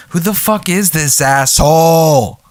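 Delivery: mock outraged. Imitating a baffled listener hearing the rambling, mock outrage. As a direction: build to a mock yell on the last word.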